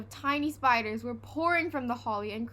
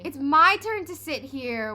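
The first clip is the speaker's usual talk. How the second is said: loud high wail; 5 semitones higher, 10 dB louder than usual. A narrator's reading. Peter wailing at Henry over the seat. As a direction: whine it out loud. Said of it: "wailed Peter".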